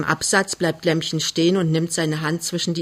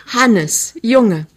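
joyful; 5 semitones higher, 6 dB louder than usual. A narrator's reading. Overjoyed after the proposal.

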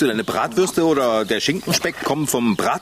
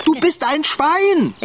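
high, slow exasperated yell; exasperated shout; 12 semitones higher, 3 dB louder, slower than usual. Acted. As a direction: finally shout it at him.

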